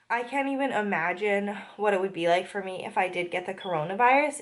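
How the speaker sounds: ordinary delivery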